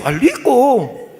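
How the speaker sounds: put-on child dutifully repeating with L; son repeating. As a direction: draw out the copied mistake. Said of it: "Hijo dice".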